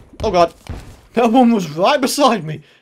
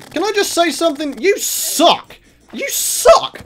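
angry yelling; 7 semitones higher, slower than usual. Real, not acted. Trapped by boss, yelling at it.

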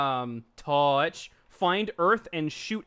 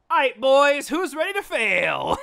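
cocky hype voice; 7 semitones higher, 5 dB louder, slower than usual. Retold or imitated. Acting past self before test.

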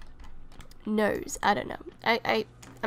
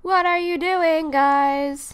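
louder, higher call at the sims; 5 semitones higher, 10 dB louder, slower than usual. Real, not acted. Calling out at the misbehaving sims, loud and higher. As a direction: shout across to get their attention.

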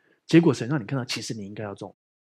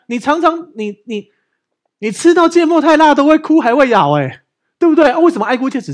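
louder, high, emphatic sermon voice; fiery preaching; 6 semitones higher, 8 dB louder than usual. Acted. Pastor hammering home his point to the church, fiery preaching. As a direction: preach it hard to the congregation.

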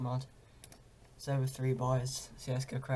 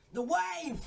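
excited; 13 semitones higher than usual. Real, not acted. Wins a round, cheers.

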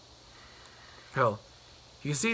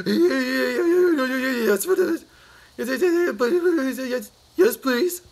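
high, louder breathless yeses; shocked and eager; 9 semitones higher, 7 dB louder than usual. Acted. Mister Jelly begging the tramp for the secret, high and frantic. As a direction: gabble yes over and over, desperate to know. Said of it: "covered and shocked".

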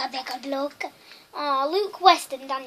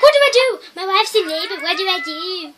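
mock panic; 3 semitones higher, 5 dB louder than usual. Player voicing a Sim husband as his wife gives birth, mock panic. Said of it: "he's panicking".

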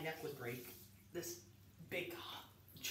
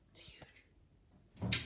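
whisper; 3 dB quieter than usual. Real, not acted. Whispering about the ice.

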